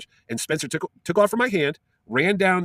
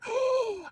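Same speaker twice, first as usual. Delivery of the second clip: gasp; 7 dB quieter than usual. Retold or imitated. Awestruck boy meets Luke.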